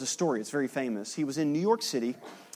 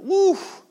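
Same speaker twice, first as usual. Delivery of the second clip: high mock "woof"; 7 dB louder than usual. Reaction after indulgent gossip.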